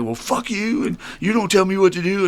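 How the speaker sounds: high-pitched mock indignation; mimicked angry outburst. Sponsee blowing up at his sponsor, mimicked indignant retort.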